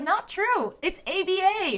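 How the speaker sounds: high, loud retort breaking into laughter; playful, laughing contradiction; mock protest. Acted rebuttal in retold joke.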